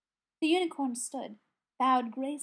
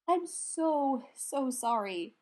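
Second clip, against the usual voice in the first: slow, drawn-out apology; apologetic; much slower than usual. A narrator's reading. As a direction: stretch the sorry out apologetically. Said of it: "Brendan said apologetically".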